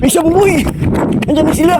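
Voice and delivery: high excited call across the field. Real, not acted. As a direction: shout it out to friends far ahead. Calling out to friends while running. (sumisigaw na tumatawag)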